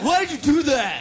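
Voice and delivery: angry retort. Retold or imitated. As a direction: imitate an angry friend demanding an explanation. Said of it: "when he's really angry at me".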